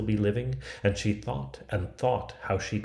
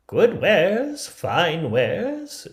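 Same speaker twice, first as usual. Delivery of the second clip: high, loud peddler voice; peddler hawking; 9 semitones higher, 7 dB louder, much slower than usual. Acted. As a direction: sell it like a crafty old hawker. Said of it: "answered she".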